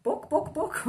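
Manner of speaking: high bok bok clucking call; chicken call. Retold or imitated. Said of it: "a German speaker would come along and be like".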